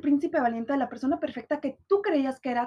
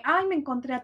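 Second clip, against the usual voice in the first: ordinary talk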